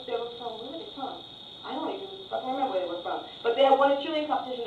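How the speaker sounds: ordinary delivery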